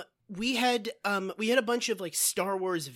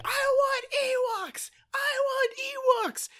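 very high, slow whining shriek; shrieking child; 4 dB louder, slower than usual. Acted. Child in the car after Return of the Jedi, shrieking. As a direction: imitate a kid's drawn-out tantrum demand. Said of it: "just shrieking".

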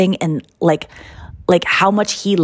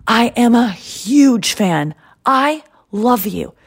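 slow, drawn-out gushing, pitched up; gushing fan; 5 semitones higher, slower than usual. Retold or imitated. Jerry greeting the comic he adores, slow emphatic gushing. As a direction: imitate a star-struck fan, stretching the key words. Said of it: "he says something like".

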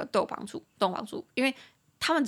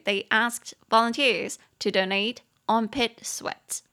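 放慢语速朗读英文句子; 清楚一字一字念; slow model reading; slower than usual. Shown as a demonstration. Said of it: "所以第二句就是". (老师慢速示范朗读)

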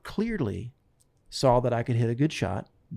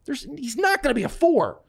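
indignant protest; 7 semitones higher than usual. Retold or imitated. Chuck protesting to Mike.

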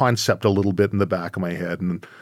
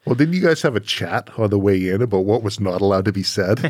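ordinary delivery

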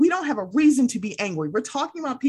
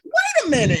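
high, far-from-usual incredulous exclamation; retold incredulity; 8 semitones higher, 4 dB louder than usual. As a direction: replay your disbelief, pitch leaping up. Retells her reaction to false claim.

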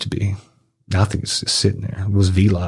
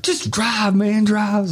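much higher mock command to get moving; urgent order; 12 semitones higher, much slower than usual. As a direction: bark it high and hurried. Crunchy ordering him to drive.

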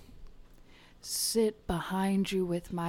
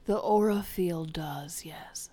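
whispering. Acted. Mystic healer whispering.

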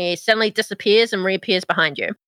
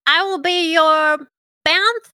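higher, eager little servant; imp voice; 8 semitones higher, slower than usual. Acted. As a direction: play a bound imp, brightly.

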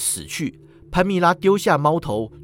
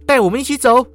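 high, loud demand; commanding; 9 semitones higher, 7 dB louder, faster than usual. Acted. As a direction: order him with sword at hand. Pamela hand on sword.

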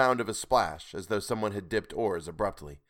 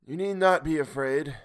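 lightly voiced strange caller, higher; queer voice; 6 semitones higher, 4 dB louder than usual. A narrator's reading. Unseen stranger reassuring the sailor, queer voice. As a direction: answer in a slightly odd, raised voice. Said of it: "answered the queer voice".

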